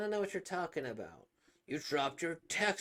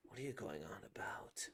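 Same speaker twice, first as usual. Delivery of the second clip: whisper; 7 semitones lower, 9 dB quieter than usual. Acted. Whispered aside.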